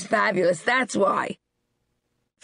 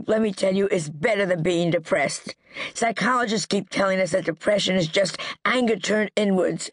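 ordinary delivery

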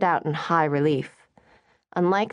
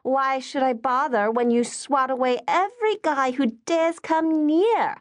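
curt answer; 9 semitones higher than usual. Acted. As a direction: shoot back at your meddling mother. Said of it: "Charlotte answered curtly".

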